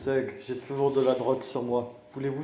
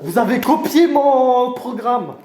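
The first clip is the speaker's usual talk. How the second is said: loud, high, drawn-out protest; indignant accusation; 10 semitones higher, 12 dB louder, slower than usual. Acted. Candidate accusing rival of stealing his programme, loud indignation. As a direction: accuse your rival, slowly and loudly.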